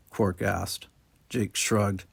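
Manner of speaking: plain reading voice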